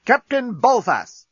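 slow high urgent call. A narrator's reading. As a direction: call out, alarmed and breathless. Corporal arrives out of breath.

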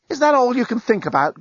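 high-pitched, mild groan of complaint; exasperated groan. A narrator's reading. Lucy to the amorous journalist, exasperated groan. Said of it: "groaned Lucy".

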